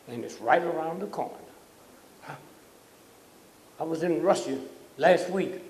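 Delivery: ordinary talk, nothing marked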